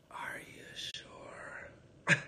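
very slow, hushed disbelief; slow whisper. Retold or imitated. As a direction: draw it out in a shocked whisper. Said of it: "And she says".

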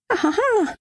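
very high staged laughter; high hysterical laugh, performed; compulsive laugh, acted. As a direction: burst into a very high "ahaha". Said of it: "Ich muss nur immer lachen".

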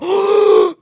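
sharp, loud, high frightened gasp. Real, not acted. Gamer nearly killed, letting out a loud frightened gasp. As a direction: gasp in real fright as danger hits. Narrow escape in game.

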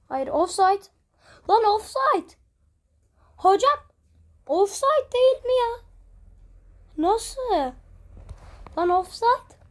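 loud, high shouting at the referee; angry offside protest. Real, not acted. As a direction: yell it out in disbelief.

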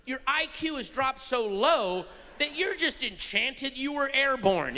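normal talking voice

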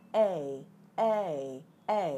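"a" as a long English ay glide; isolated vowel sound. Shown as a demonstration. Teacher asking viewers to say the vowel right, long "a". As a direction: drill "a" slowly, gliding from e to i. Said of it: "giúp Thủy nói là âm e".

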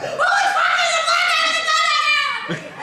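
slow, high, loud terrified shouting; panicked shout. Retold or imitated. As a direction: yell it in terror, drawn out.

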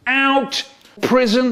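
high barked command; shouted order. Acted. Inspector barks order.

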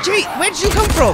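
high startled yelp. Real, not acted. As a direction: jump in surprise. Startled by sudden zombie.

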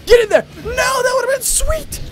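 very high, loud anguished yell; shout. Real, not acted. Gamer screaming as his shot just misses, anguished shout. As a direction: scream it at the near miss.

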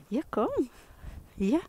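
high, coaxing call; high dog call. Real, not acted. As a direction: coax the dog to come. Calling dog in baby voice.

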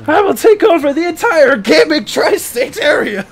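villain voice. Acted. Villain declaration after laugh.

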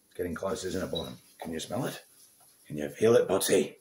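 ordinary talk

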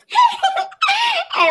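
very high shrieking laugh; gloating cackle. Acted. Bully cackling at the punished girls. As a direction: cackle in triumph.

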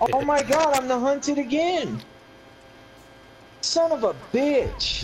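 groaning at bad luck, voice up high; exasperated outburst. Real, not acted. Player hunted again, high exasperated cursing at Scott.

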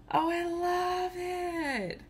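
high, slow, soft gush; adoring coo. Real, not acted. Admiring her new tumbler, adoring coo. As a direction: coo it softly and draw it out.

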